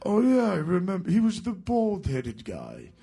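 Geezer voice. Retold or imitated. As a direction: raise the voice into a mild Brummie bandmate. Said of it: "And he goes".

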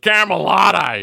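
put-on loud, high, drawn-out bogan Dubbo accent; hammed-up broad Dubbo bogan. Host mocks what the waitress heard, hammed-up bogan accent. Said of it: "the Bogan, uh, Dubbo accent".

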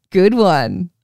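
ordinary delivery

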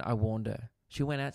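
ordinary talk, nothing marked

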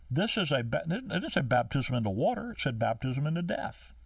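usual speaking voice

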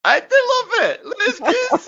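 laughing in a high squeal; being tickled. Real, not acted. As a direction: giggle through the words, high. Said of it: "That tickled you".

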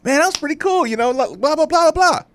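mock excited chatter trailing into blahs; retold excitement. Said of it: "I could hear the excitement in his voice".

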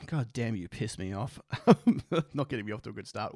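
ordinary talk

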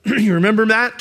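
normal talking voice

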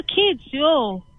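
ordinary talk, nothing marked

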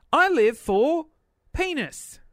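playful, gleeful exclamation; cheeky punchline. Pretend. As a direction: land the gag with glee.

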